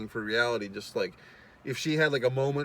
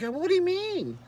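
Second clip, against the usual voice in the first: much higher mock girl voice; girlish question. Girl confused by his claim, high mock voice. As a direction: ask it innocently in a high girly voice.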